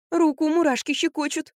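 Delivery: high, whiny grumble; complaining. A narrator's reading. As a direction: complain like a sufferer. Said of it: "пожаловалась Катя". (высокая плаксивая жалоба)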